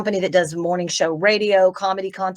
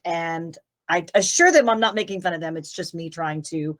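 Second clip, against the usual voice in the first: ordinary delivery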